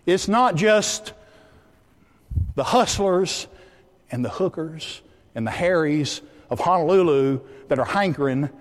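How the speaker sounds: forceful phrases with weighty pauses; emphatic preaching. Real, not acted. Preacher applying the story to his listeners, emphatic. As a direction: drive each phrase home to the congregation. Preacher drives home the moral.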